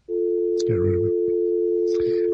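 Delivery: very slow whispered get rid of him; whispered order to cut the caller; whispered dismissal. Real, not acted. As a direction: breathe it out like a stage aside. Dumping a racist caller.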